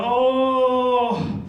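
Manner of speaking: high, drawn-out "oh" of agony; pained groan. Acted. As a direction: groan at the dreadful news. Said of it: "And I go".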